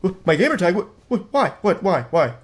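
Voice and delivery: mock panicked stuttering; nervous stammer. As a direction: stammer like you're cornered. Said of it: "he's starting to s-, sweat a little bit".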